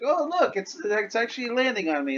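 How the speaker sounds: high-pitched, hurried delight; delighted audience member. Acted. Audience member marvels at glitter landing on them, delighted. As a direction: act out an excited spectator, voice lifted.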